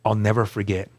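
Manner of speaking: ordinary talk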